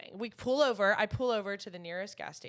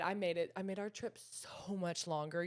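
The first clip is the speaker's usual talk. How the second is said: usual speaking voice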